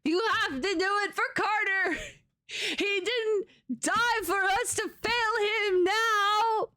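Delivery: rally the troops as if all depends on it; dramatic rallying plea. Pretend. Mock-dramatic rallying plea during tense game.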